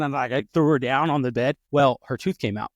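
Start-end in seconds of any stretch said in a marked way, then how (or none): none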